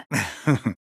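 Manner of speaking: low, gloating scientist's cackle; sinister chuckle. Acted. Scientist gloating over the experiment, sinister chuckle. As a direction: chuckle darkly to yourself.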